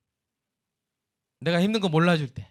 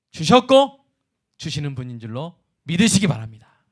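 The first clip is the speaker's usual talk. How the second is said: raised, ringing preacher's voice; fervent preaching; 7 semitones higher, 6 dB louder than usual. Acted. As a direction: proclaim it loudly to the congregation. Preaching at full force. (힘차게 외치는 설교)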